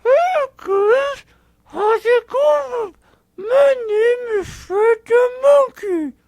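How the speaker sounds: slow, loud ventriloquist talk; talking with mouth closed. Shown as a demonstration. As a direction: talk without moving your lips. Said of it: "I can talk without moving my mouth. Look".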